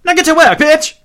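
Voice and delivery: roaring, very high loud imitation; hammed-up high shout. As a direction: come roaring back, pitch way up. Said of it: "she comes roaring back with".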